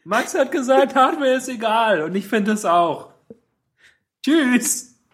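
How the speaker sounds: high, cocky declaration with a laugh; cheeky mock announcement. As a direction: announce it smugly, then walk off. Mock bold announcement to lecturer.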